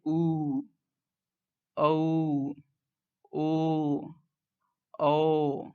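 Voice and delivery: "उ, औ, ओ, औ" each held on rounded lips; rounded vowel demonstration. Shown as a demonstration. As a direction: sound out "उ, औ, ओ, औ" singly, lips rounded, pausing between. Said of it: "ओठ हैं वो गोलाकार रूप धारण".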